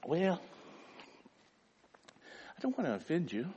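hesitant, apologetic mumble with long pauses; meek, low-energy pitch that gives up; timid, half-hearted witnessing. Acted. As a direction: back away politely as you talk. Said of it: "But we say it like this".

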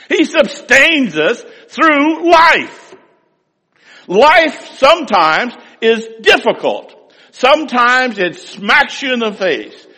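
high, loud, slow preacher's proclamation; rising sermon fervour, loud and slow; full-force preaching. Acted. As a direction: preach it out to the congregation.

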